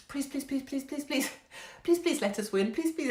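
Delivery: high-pitched mock pleading, please after please; desperate praying. Fans on Twitter praying for a win, mock-desperate pleading. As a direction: beg as if praying for a miracle. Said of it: "were on Twitter just praying".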